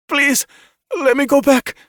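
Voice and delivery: high, loud desperate plea; woman begging her captors. A narrator's reading. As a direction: beg them to let her go. Pinned down, begging.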